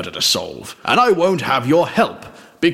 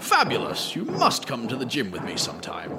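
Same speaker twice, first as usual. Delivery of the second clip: a breathy, much softer fabulous; flirty hush; 8 dB quieter than usual. Acted. Detective invites the butler to the gym, softly cooing.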